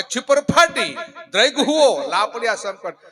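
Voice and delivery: high, loud verse recital; declaimed answering verse. Acted. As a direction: ring out the reply couplet. (ઊંચા, બુલંદ અવાજે દુહો)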